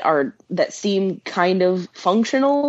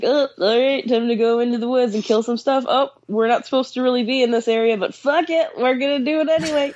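higher, quick, mocking dumb-bro tone; mocking hunter voice; 7 semitones higher than usual. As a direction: sneer it like careless hunters. Mocking careless hunters' talk.

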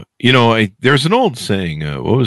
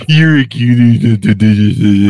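louder, playful cooing; sweet baby talk to dog; 4 dB louder than usual. Pretend. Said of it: "It's about the tone I use".

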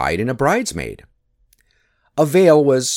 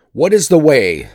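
louder, higher question; urgent demand; 5 semitones higher, 6 dB louder than usual. A narrator's reading. Thor asking Loki the way, urgent demand. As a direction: demand it as a bluff warrior.

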